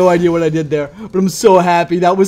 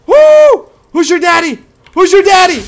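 1 excited taunt; 13 semitones higher, 10 dB louder than usual. Real, not acted. Cheering a kill mid-game.

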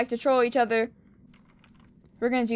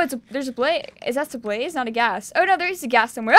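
ordinary talk

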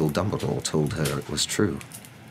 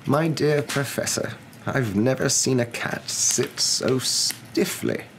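higher, slow, drawn-out character voice; old wizard; 9 semitones higher, slower than usual. Acted. Wizard answers his professor friend about the cat, slow teasing. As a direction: savour each word slowly, teasing gently.

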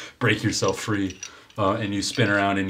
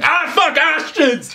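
much higher and louder panicked yell; screaming; 6 dB louder, slower than usual. Acted. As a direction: yelp it out as your hand burns. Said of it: "you're screaming".

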